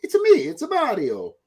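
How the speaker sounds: high cartoon Italian-accented voice; playful high impression with Italian accent; Mario. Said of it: "I didn't hear any inflections of".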